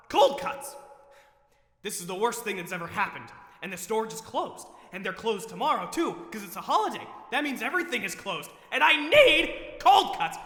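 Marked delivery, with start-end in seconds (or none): 0.1-0.8 despairing whisper. Acted. Despairing over forgotten cold cuts. 8.7-10.4 desperate plea. Acted. Desperate for cold cuts.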